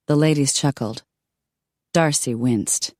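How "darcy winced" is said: ordinary delivery, nothing marked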